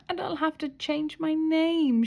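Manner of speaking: high, mildly tearful plea; lightly voiced sob through the words; mock sobbing. A narrator's reading. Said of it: "she sobbed".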